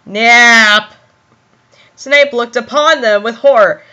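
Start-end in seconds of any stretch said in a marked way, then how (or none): 0.0-0.9 slightly lowered, drawn-out menace; understated sinister voice; low, cold. A narrator's reading. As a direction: say it coldly, stretched out. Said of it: "in a low, cold voice".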